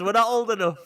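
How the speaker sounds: high, loud whiny protest; mock panicked worms. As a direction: kids caught at the cinema. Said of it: "worms at cinema".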